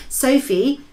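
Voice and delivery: ordinary delivery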